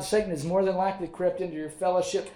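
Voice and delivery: normal talking voice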